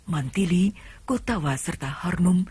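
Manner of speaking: normal talking voice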